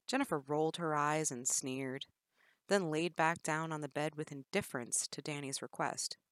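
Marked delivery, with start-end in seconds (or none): none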